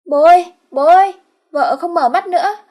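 high, loud cry for father; panicked yelling. Acted. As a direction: shout for help in panic. Ất runs out calling father.

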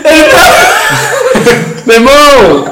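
loud laughter breaking the words; laughing shout. Real, not acted. Telling friends the climax, laughing loudly. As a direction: shout it while cracking up.

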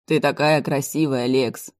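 plain reading voice

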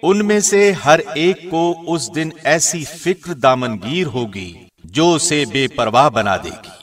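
normal talking voice

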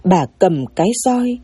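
ordinary delivery

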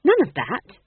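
high, loud snap; curt, irritated reprimand; snapped. A narrator's reading. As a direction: cut him off sharply. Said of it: "snapped Tom John".

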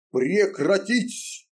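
slow, high commanding bellow; booming command. A narrator's reading. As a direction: thunder the order. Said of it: "Повелительный голос прогремел".

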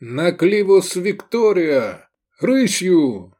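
high, loud marching order; drawn-out command call. A narrator's reading. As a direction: order the troops off at a trot. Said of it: "скомандовал Лобанов".

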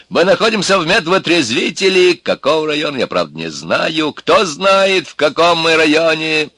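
agitated bespectacled man. Acted. Bespectacled man asks his cellmates where they are, agitated. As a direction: raise the voice in agitated bewilderment.

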